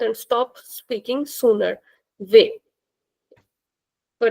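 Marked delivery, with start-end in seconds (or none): 2.2-2.6 "Ve" with a closed e, the sound finished quickly; "Ve" cut short, mouth closing early on the e; "Ve" with a tight e like "vay". Shown as a demonstration. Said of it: "We'll finish the sound sooner".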